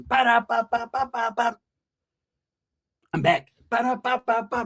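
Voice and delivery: high vocal pa pa pa like shots; mock gunshot sounds. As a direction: spit out pretend gunfire. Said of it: "That vato's out there doing".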